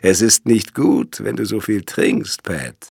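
mocking reproach. A narrator's reading. Intruder mockingly scolds his startled brother.

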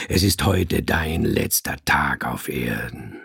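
very low, controlled threat; deep, hard, calm. A narrator's reading. Cat threatens the man with death, deep and calm. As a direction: pronounce sentence in a deep, calm voice. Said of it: "die war tief und hart und ruhig".

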